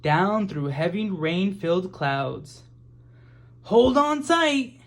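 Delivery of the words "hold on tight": much higher, louder and slow; urgent warning. A narrator's reading. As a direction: brace the children for a bumpy landing. Submarine about to land.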